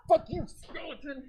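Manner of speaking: playful laughing outburst; laughing shout. Real, not acted. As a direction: bark it at the skeleton through a laugh.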